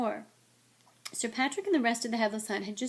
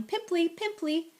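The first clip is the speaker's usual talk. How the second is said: mild taunting yell; 8 semitones higher than usual. A narrator's reading. Peeves pelting the fleeing Myrtle, jeering. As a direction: call it after her in a high taunt.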